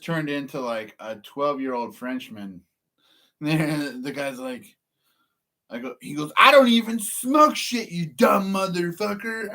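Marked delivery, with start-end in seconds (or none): 6.4-9.5 higher, slow, louder mock thug; angry worker. Worker yells at accuser, higher, louder mock anger. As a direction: bark it back like the riled guy.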